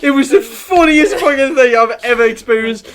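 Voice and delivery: loud, high words through laughter; helpless laughter. Real, not acted. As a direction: gasp out the memory while cracking up. Laughing hard at the memory.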